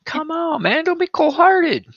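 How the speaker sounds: squeaky begging voice; high-pitched pleading. Pretend. Pleading about dogs.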